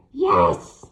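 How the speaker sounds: loud low shout of triumph; hag's triumphant yell; screamed. A narrator's reading. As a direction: yell it at last getting it right. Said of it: "she screamed".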